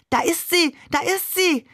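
put-on high, excited child-figure cry; excited calling out. A narrator's reading. Seestern spots Maman on television, excited high call. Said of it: "rief der Seestern".

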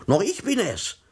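high, loud and worked up; heated outburst. A narrator's reading. Herr Thomsen bristling at the sleepy policeman on the phone, heated. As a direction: flare up in indignation, pitched high. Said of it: "ereiferte sich Herr Thomsen".

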